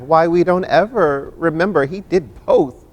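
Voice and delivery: ordinary talk, nothing marked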